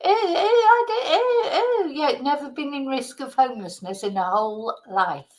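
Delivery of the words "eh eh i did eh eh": high scornful cackling laugh; mocking laugh. Real, not acted. Vlogger laughing at the minister's clip, scornful high laugh.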